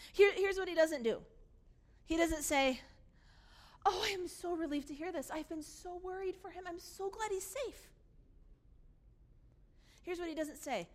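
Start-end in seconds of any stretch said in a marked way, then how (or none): 3.9-7.9 high, softening sigh of relief; acted relief. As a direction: let the worry go in a high, tender voice. Acts relief the brother doesn't feel.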